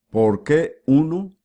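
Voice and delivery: ordinary talk, nothing marked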